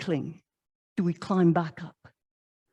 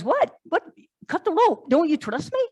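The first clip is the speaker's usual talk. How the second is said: higher, shocked protest; shocked disbelief; 7 semitones higher than usual. Acted. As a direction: balk in disbelief at the order. Climber shocked at command.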